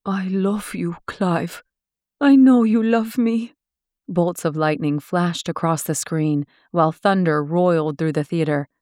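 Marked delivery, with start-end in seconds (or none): 0.0-3.5 lover's plea building to a cry. A narrator's reading. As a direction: push your love at him, rising. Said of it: "Laura begged".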